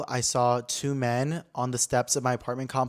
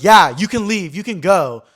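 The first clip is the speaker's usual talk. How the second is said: mock rude officer; 5 semitones higher, 10 dB louder than usual. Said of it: "being hella rude".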